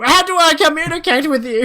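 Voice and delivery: high, loud mock shout; exasperated outburst. Frustrated passenger to the stranger he can't understand, mock exasperated. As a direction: cry out as if shaking him by the head. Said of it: "I wanted to grab his head and shake him".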